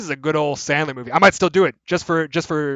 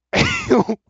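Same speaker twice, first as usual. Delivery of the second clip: high laugh; 4 dB louder than usual. Real, not acted. Laughs at own bit.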